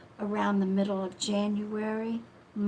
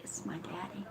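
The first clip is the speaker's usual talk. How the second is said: ordinary talk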